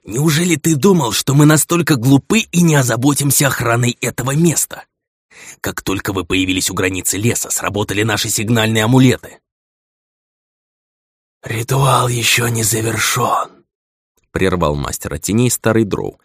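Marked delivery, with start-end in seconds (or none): 0.0-9.4 high, gloating villain voice; mustache twirling villain. Acted. Villain mocks the betrayer. 11.4-13.6 put-on slow, stern old drow voice; old commanding elder.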